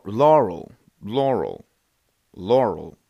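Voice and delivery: machine-like falling Laurel; computer voice Laurel. Shown as a demonstration. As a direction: demo the first word. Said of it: "two distinct sounds".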